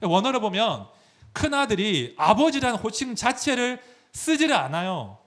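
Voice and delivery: ordinary delivery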